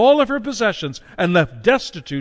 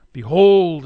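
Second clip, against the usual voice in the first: second behold pushed louder; 2 semitones higher, 9 dB louder than usual. Acted. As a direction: call out behold.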